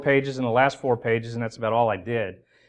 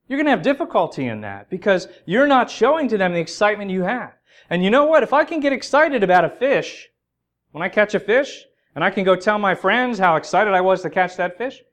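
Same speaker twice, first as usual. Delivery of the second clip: higher, louder, driving preaching; 7 semitones higher, 5 dB louder than usual. Acted. Preacher urging the congregation, at full preaching force. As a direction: press the point home to the church. Climax of sermon intro.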